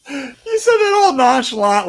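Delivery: high, loud words through laughter; real laughter carrying the words; laughing while talking. Real, not acted. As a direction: speak while still cracking up. Laughing at partner's deadpan line.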